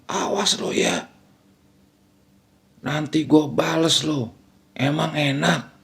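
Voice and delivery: mock hysterical cry, restrained in volume; hysterical threat. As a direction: imitate a possessed-sounding wife threatening revenge. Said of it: "sambil teriak histeris itu langsung lanjutin omongan".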